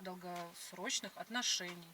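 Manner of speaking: usual speaking voice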